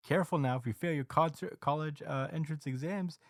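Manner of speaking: mock the kid like a smug TV host; lightly voiced smug announcer. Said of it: "the T V announcer is like".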